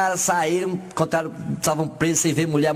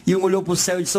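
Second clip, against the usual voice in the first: normal talking voice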